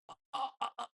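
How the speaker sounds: soft, high put-on moans of pain; short faked gasps of hurt; fake pain groans. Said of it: "లోపల అంత బాధ లేకపోయినా అంత నొప్పులు". (నొప్పి నటిస్తూ మూలుగు)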